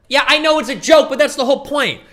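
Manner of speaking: high, loud, insistent comeback; exasperated retort. Real, not acted. Reactor answering the joke excuse, exasperated retort.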